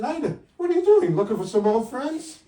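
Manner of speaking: high, loud mock taunt; teasing jab. Son-in-law taunts the old mother-in-law, high teasing jab. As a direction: rib her loudly.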